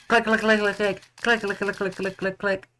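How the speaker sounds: rapid-fire high clicking chant; high staccato repeats, fading; imitated shutter clicks. Imitating the camera shutter firing.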